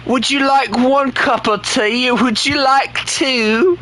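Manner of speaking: a silly high tea-party voice; mock posh British. Streamer mocking British viewers with a fake tea offer, hammed-up British.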